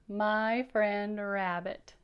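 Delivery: slow, each word drawn out; careful slow reading; slow title read. Shown as a demonstration. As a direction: read the title slowly and clearly. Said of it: "Can you read that?".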